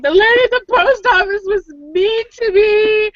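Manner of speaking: high, whiny mock complaint; imitating her own whimper. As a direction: replay the tearful tattling, half laughing at herself. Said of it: "I came home and I was crying".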